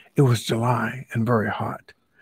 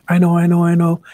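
loud, high, eager blurting; bursting to answer first; eager know-it-all; 6 semitones higher, 8 dB louder, faster than usual. Acted. Alligator eager to answer Stork, loud and high. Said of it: "said Lion".

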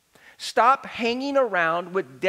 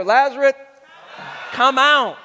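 loud, higher summoning command; raised voice, commanding; loud calling out; 4 semitones higher, 5 dB louder than usual. Acted. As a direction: call someone out of a tomb. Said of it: "he called out in a loud voice".

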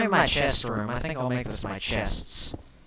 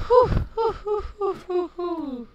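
high breathless relieved whews; high panting whews; 6 dB louder than usual. Real, not acted. Gamer panting in relief after the fight. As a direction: pant out the relief.

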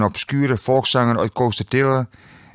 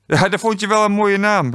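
high, amused echo; laughing, mocking; 9 semitones higher than usual. A narrator's reading. Quiz host teasing a contestant's excuse, laughing and high. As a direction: throw it back as if laughing, pitched way up. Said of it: "lachte Astrid".

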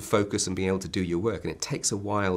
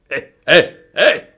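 booming giant hey, much louder and higher; giant shout; 11 semitones higher, 15 dB louder, slower than usual. Acted. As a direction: bellow it like a giant. Giant voice shouting after rhyme.

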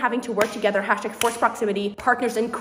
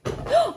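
sharp high delighted gasp; excited gasp. Real, not acted. Opening a package and spotting the book, high gasp. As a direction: gasp at the sight.

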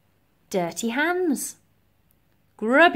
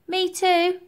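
put-on high-pitched orphan boy; small boy's voice chiming in; Percival; 9 semitones higher, 3 dB louder than usual. A narrator's reading. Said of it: "added Percival".

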